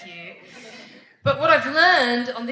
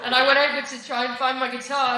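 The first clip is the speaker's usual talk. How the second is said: normal talking voice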